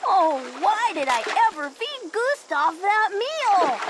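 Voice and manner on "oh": plain reading voice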